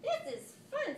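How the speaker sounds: high, giggly little sister voice; kitten sister. A narrator's reading. Jezebel the kitten sister delighting in the game, high giggly. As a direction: squeal it out like a gleeful kitten. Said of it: "said Jezebel".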